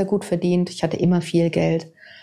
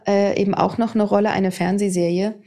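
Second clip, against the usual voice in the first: ordinary talk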